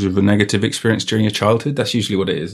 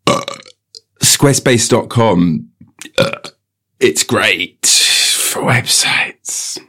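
slow, drawn-out ad delivery; mock sponsor read; slower than usual. Reading the episode's sponsor message, slow mock ad voice. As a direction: stretch out each phrase like a hammy advert.

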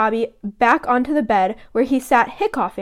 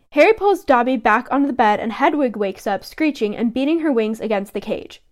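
usual speaking voice

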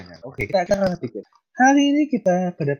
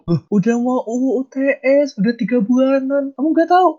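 shocked disbelief; 11 semitones higher, 6 dB louder than usual. Acted. Acted skit, classmate shocked.

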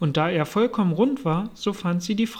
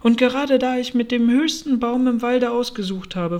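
pigeon's higher-pitched, worked-up complaint; raised voice; 5 semitones higher, 4 dB louder than usual. A narrator's reading. Pigeon chose the highest tree, complaining in raised voice. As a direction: raise your voice in grievance. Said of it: "mit erhobener Stimme fort".

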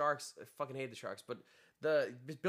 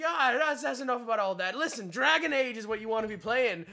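mock pushy friend; 9 semitones higher, 6 dB louder than usual. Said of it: "And he's like".

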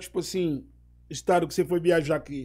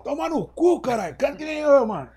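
high, loud yell at a singer; yelling across the room; 8 semitones higher, 4 dB louder than usual. Retold or imitated. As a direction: bellow it from the next room. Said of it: "Ele gritava lá de dentro".

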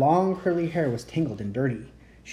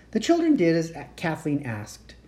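plain reading voice

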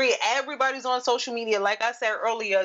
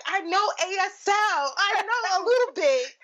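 slow, higher-pitched mimicry; drawn-out, raised-pitch show-off imitation; mock boasting; 7 semitones higher, slower than usual. Hearing stranger announces some sign language to a signer, mock boasting.

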